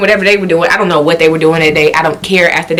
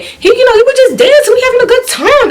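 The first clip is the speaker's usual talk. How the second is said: gushing. Real, not acted. Gushing about the dance.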